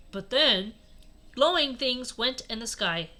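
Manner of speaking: ordinary delivery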